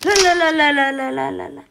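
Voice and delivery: rising panicked cries of no, fading at the end. Real, not acted. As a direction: shout no over and over in alarm. Panic as ball rolls at her. (صرخة ذعر)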